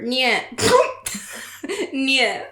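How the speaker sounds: silly high "Nyeh" with a laugh; funny sound. Shown as a demonstration. Reading her old joke, makes a silly nyeh noise. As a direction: bleat a goofy nyeh. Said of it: "making funny sounds".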